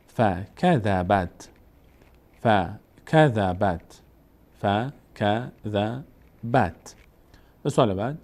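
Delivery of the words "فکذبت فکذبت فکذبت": very slow, measured "فکذبت", syllable by syllable; slow reading demo. Shown as a demonstration. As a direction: spell out "فکذبت" slowly for a learner. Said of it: "ت رو چطور بخونیم؟". («فکذبت» خیلی آهسته و هجا به هجا)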